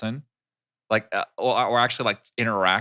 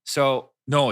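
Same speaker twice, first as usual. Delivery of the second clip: usual speaking voice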